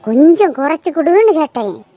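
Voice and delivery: ordinary talk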